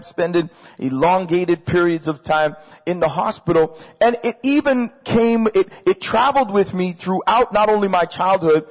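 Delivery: normal talking voice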